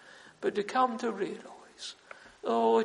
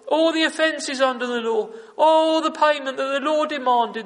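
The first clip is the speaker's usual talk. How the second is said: impassioned preaching; 2 semitones higher, 7 dB louder than usual. Acted. Preacher proclaiming Christ's sacrifice, full fervour. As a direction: cry it out to the congregation.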